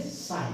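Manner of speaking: साइन said "sine", no g before n; silent g. Shown as a demonstration. Teacher answering how we say it, silent g. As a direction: drop the g in साइन. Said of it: "और हम क्या बोलेंगे?".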